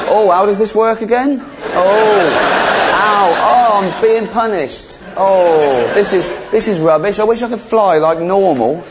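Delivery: high, hammed-up moaning; snake faking suffering. Snake pretending crawling is a punishment, mock-suffering high voice. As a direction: wail with badly faked misery.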